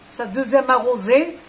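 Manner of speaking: normal talking voice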